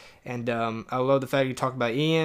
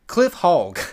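"Cliff Hogg" said higher and louder as a proud announcement; full name; 4 semitones higher, 4 dB louder than usual. Shown as a demonstration. Insisting the cohost say both names with emphasis. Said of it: "You gotta say his last name".

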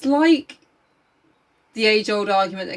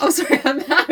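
usual speaking voice

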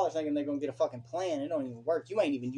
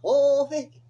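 a big, much higher and louder mock whoa; mocking Vicky; 10 semitones higher, 10 dB louder, slower than usual. Topping off the rant about Vicky with a loud, high mock. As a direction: belt her catchphrase out in full mockery. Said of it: "That's how she talk".

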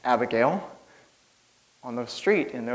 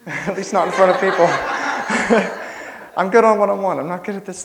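laughing through the words, louder and higher; nervous laughing; 6 semitones higher, 8 dB louder than usual. Real, not acted. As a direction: giggle nervously about public speaking. Nervous about speaking to crowd.

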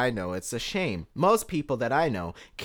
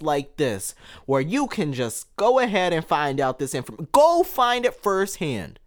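heated; 7 semitones higher, 4 dB louder than usual. Real, not acted.

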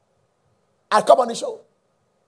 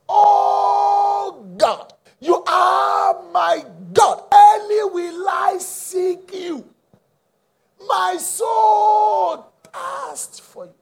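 high, loud cry with very long-held "Oh" and "are"; slow, stretched-out preacher's shout; deliberate emphasis; 12 semitones higher, 7 dB louder, much slower than usual. Real, not acted. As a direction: draw each phrase out like a fervent plea to God. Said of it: "I'm deliberate emphasizing it".

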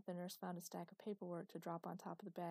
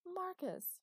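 high, loud exasperated call of his name; exasperated shout; 13 semitones higher, 6 dB louder than usual. A narrator's reading. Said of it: "she shouted in exasperation".

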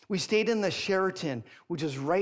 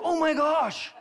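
loud, high panicked cry; retold freak-out; 12 semitones higher, 7 dB louder than usual. Dad seeing pink in the pool, acting freaked out. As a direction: re-enact a dad's alarm, pitched way up. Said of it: "and I'm, I freak out".

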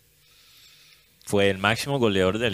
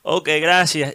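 higher, louder teasing singsong; mocking voice; 7 semitones higher, 4 dB louder than usual. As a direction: taunt him, sly and mocking. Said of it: "en una voz burlona".

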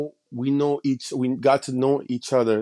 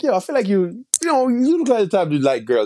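teasing suspicion; 7 semitones higher, faster than usual. Retold or imitated. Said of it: "I was like".

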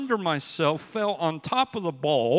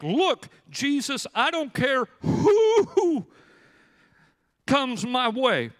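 higher, slow, swaggering Peter boast; brash Peter; 5 semitones higher, slower than usual. Retold or imitated. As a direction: puff up like a cocky fisherman.

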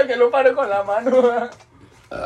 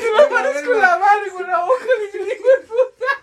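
laughing shout; 9 semitones higher, 3 dB louder than usual. Real, not acted. Drunk laughing outburst.

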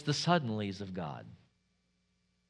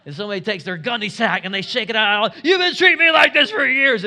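higher and louder, shouting out the years of complaints; acted blowup; 5 semitones higher, 10 dB louder than usual. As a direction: let the pent-up grievance burst out. Preacher acting out blowup.

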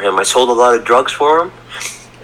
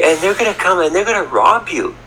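higher-pitched mock worry; retold nervous warning, pitched up; nervous mother; 7 semitones higher than usual. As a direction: imitate a mother warning her son, mildly anxious. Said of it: "she's getting a little nervous".

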